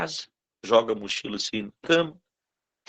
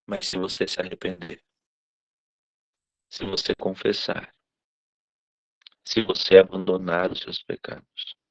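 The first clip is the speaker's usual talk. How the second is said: gentle sermon appeal; 4 semitones lower than usual. Acted. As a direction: lower your voice and plead gently. Pastor's soft appeal to congregation.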